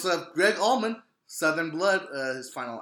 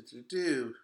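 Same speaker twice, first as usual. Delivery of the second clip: ordinary delivery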